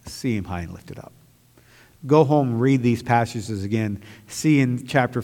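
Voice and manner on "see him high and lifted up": lower, softer, intimate appeal; quiet heartfelt charge to the congregation; soft urging. Acted. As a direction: lean in and say it gently. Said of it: "I want to encourage you today".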